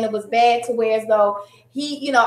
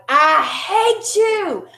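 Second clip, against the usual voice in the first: mock angry roar; 5 semitones higher, much slower than usual. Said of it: "it rumbled the house".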